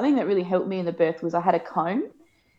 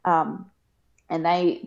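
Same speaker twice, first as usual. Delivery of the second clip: normal talking voice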